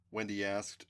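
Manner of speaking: ordinary delivery, nothing marked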